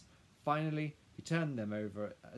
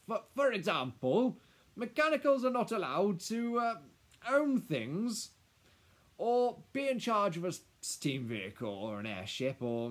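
high, loud, stammering legal list, tailing down at the end; flustered lawyer; 8 semitones higher, 10 dB louder than usual. Acted. Lawyer looking to Madame for help, flustered. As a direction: bluster through the rules with hesitations.

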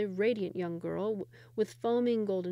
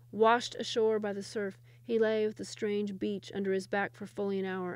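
usual speaking voice

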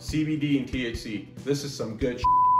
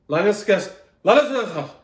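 tag-less outburst; 6 semitones higher, 6 dB louder than usual. Acted. Mock struggle with syndrome name.

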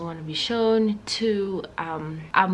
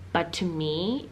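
normal talking voice

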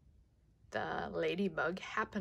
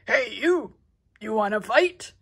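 shouting challenge; 3 semitones higher, 8 dB louder than usual. Acted. Ladybug shouts at whale fin.

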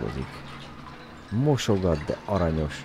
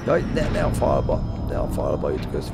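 normal talking voice

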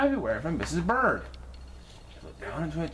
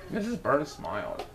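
usual speaking voice

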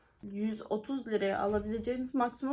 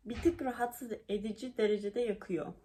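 ordinary talk

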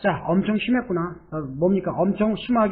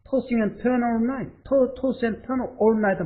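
slow model read; 4 semitones higher, much slower than usual. Shown as a demonstration. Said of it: "teacher models the phrase".

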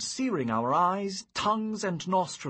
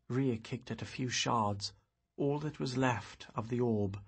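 plain reading voice

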